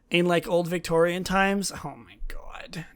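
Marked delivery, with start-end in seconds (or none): 1.8-2.9 hushed, breathless whisper of delight; awed whisper. Real, not acted. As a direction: whisper it like you can't believe your eyes. Awed at unboxing the set.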